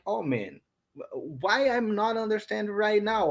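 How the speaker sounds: ordinary talk, nothing marked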